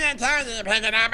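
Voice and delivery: light sing-song mimic of his friend; mocking his friend. Teasing his friend by parroting him, mock voice. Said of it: "you made fun of me back there".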